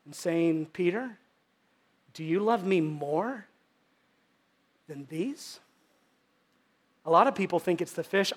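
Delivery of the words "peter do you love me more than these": quiet, drawn-out asking; soft question. Shown as a demonstration. Jesus pointing to disciples, soft and slow. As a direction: ask it gently, almost under breath. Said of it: "the tone that he said it".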